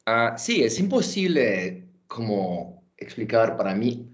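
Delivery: Spanish with a clear American accent; English speaker speaking careful Spanish. Real, not acted. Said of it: "pidiendo perdón por mi español".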